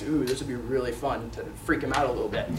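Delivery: put-on soft, low voice for a kid's secret plan; gleeful scheming thought. Boy plans to freak Dad out, acted hushed scheming. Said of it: "but he thinks".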